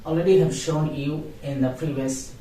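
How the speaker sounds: normal talking voice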